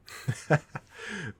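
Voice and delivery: short amused villain chuckle. Acted. Villain amused by the captive hero, chuckling. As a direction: let out a brief, teasing laugh.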